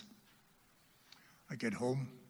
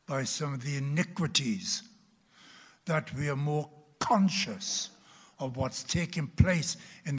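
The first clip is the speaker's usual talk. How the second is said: rising, louder preaching; fervent preaching; 4 semitones higher, 5 dB louder than usual. Real, not acted. Preacher rousing the church about worldly distractions. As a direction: build it like a sermon climax.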